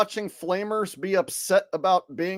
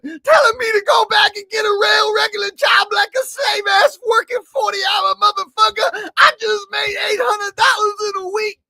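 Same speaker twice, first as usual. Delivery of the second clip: very high, loud, fake-sobbing shouts; mock tantrum parody of the TikToker, fake-crying and shouting; hammed-up crying rant; 7 dB louder than usual. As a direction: wail and shout like a spoiled crybaby.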